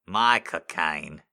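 Michael Caine said slow and firm; slow, loud Michael Caine. Shown as a demonstration. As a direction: stretch Michael Caine out slowly. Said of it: "which was which".

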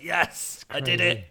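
high, loud cry of victory; mock hysterical triumph. Podcaster imitating the Joker exulting as he falls, mock triumph. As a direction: crow it as the gloating villain. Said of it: "He's just laughing hysterically".

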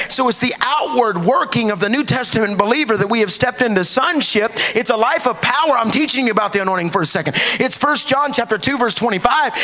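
high, driving sermon delivery; fired-up preaching. Real, not acted. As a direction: preach it at a high, insistent pitch. Preaches at full force.

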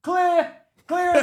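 high, loud "clear" from upstairs; officer calling out. Retold or imitated. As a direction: call it like police sweeping rooms. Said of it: "all I can hear from upstairs".

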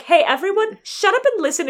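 high, loud call over a crowd; hammed-up exasperated hushing; mock calling for order. Hushing a clamouring courtroom.

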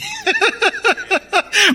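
very high, sustained laughter; real laugh. Real, not acted. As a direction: laugh high at your own joke. Preacher laughing at own demonstration.

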